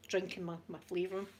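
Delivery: normal talking voice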